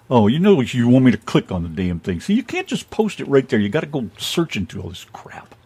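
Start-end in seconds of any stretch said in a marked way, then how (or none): 3.6-5.6 whispered grumble. Real, not acted. Muttering complaint at website.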